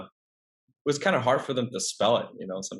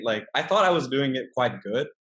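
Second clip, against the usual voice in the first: ordinary delivery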